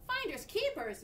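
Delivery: high, loud taunting call; calling back triumphantly. A narrator's reading. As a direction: toss it back over her shoulder. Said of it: "called over her shoulder".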